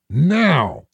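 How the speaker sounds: one loud, explosive syllable; barked command. Acted. Admiral drives the officers back into chairs, barking. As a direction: bark it like a blow. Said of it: "he barked".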